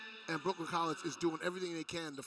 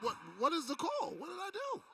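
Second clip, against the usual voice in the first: rising squeaky disbelief, much higher; mock player protest; 12 semitones higher than usual. Color commentator imitates the player begging the referee, high mock whine. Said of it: "she's like".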